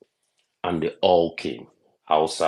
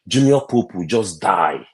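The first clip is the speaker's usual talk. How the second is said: ordinary talk, nothing marked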